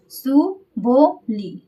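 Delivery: very slow, सुबोली split into su, bo, li; drawn-out mnemonic. Shown as a demonstration. Teacher giving students a memory trick, drawn-out syllables. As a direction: stretch each syllable of सुबोली separately.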